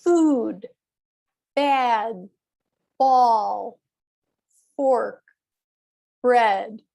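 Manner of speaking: food, bad, ball, fork, bread each said alone, loud and stressed; slow stressed words. Shown as a demonstration. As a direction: model each one-syllable word with clear stress. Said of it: "read the words and show that it's one syllable".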